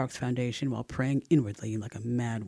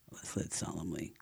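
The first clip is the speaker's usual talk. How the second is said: plain reading voice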